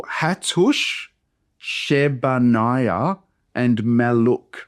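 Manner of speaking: "Hattush", "Shebaniah", "Maluk" with every syllable separated; slow, by syllable. Shown as a demonstration. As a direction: draw out "Shebaniah" as she-ba-nai-ah. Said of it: "I'm gonna pronounce them slowly".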